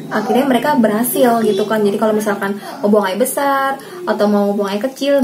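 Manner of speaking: ordinary delivery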